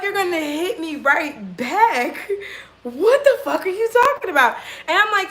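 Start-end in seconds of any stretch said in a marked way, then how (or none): 0.0-2.3 slow, higher, drawn-out repeat; incredulous echo. Retold or imitated. Incredulously repeats his words back. 2.8-4.6 high-pitched, laughing disbelief; incredulous retort. Retold or imitated.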